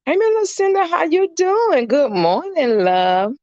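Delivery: very high, drawn-out sing-song hello; high sing-song greeting. Real, not acted. Greeting a live viewer, high sing-song hello. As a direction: greet her way up high, stretched out.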